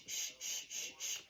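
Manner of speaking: soft unvoiced sh hiss; quiet repeated sh; sh sound. Shown as a demonstration. Teacher models the sh sound softly.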